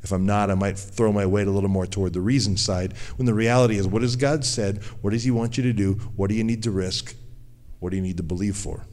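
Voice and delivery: ordinary talk, nothing marked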